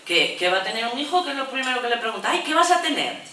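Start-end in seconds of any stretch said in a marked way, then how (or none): 2.3-3.2 mock nosy neighbour. Mocking nosy passerby on street.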